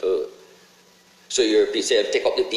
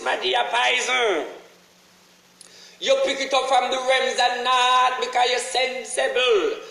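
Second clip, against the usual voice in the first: preaching rant; 13 semitones higher than usual. Acted. Preaching rant at full force.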